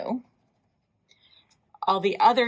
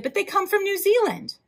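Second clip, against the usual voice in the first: high surprised objection; 11 semitones higher than usual. Acted. Frida objects to the name.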